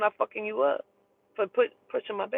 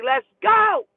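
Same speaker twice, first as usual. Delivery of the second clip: urgent call; 11 semitones higher, 7 dB louder than usual. Retold or imitated. Urging daughter to leave.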